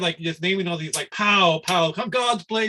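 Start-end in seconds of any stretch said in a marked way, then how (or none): 1.1-2.7 mocking imitation. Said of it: "he did not like the God's play part".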